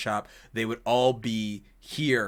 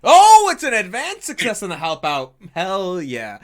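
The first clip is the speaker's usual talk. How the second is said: high, loud burst of delight; real excitement; 7 semitones higher, 12 dB louder than usual. Real, not acted. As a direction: exclaim over a great dice roll. Game master reacts to great roll.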